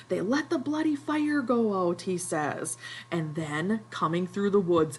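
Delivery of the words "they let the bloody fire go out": higher, lightly acted fury; Ralph's outrage, voiced half in earnest; livid. Ralph furious at the hunters, higher mock rage. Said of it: "Ralph is livid".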